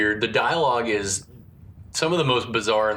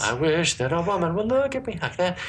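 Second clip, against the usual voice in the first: mock wistful voice; 7 semitones higher than usual. Voicing himself mocking line.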